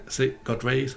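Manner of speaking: ordinary delivery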